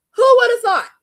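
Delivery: high, loud sarcastic outcry; mock astonishment. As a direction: exclaim it as if utterly shocked. Sarcastic outcry after gee willikers.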